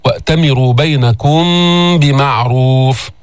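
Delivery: slowly recited, lingering on the م before بمعروف; ghunna before ba. Shown as a demonstration. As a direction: chant بينكم بمعروف with the meem hummed into the b. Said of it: "بغنة عند الباء".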